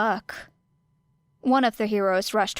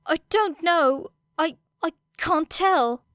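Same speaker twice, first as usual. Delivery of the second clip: frightened; 8 semitones higher than usual. A narrator's reading. Frightened classmate unsure.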